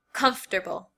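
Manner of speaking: comfortable squeezed to three syllables, KUMF-ter-bul. Shown as a demonstration. Teacher showing students her everyday comfortable. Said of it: "this is how I normally say the word".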